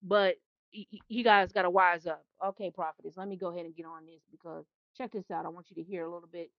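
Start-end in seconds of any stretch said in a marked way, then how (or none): 2.4-6.5 dropped to a soft aside, half to herself; quiet aside. Real, not acted. Prophetess quietly talking herself into playing the clip, murmured aside. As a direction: murmur it as an aside before hitting play.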